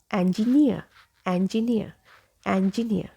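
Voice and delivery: "engineer" as en-ji-NEE-uh; correct engineer. Shown as a demonstration. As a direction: begin "engineer" like the end of "pen". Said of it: "So this is the correct pronunciation".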